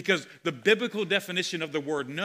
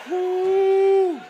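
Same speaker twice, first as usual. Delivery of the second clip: excited whoop; 13 semitones higher, 10 dB louder than usual. Real, not acted. Preacher exclaiming mid-sermon.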